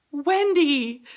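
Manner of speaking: high, loud whimpered cry of a name; whimpering call. Retold or imitated. Woman in labor calling her nurse back, high whimpering cry. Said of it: "I kind of whimpered".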